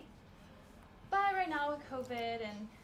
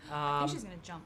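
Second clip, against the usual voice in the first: normal talking voice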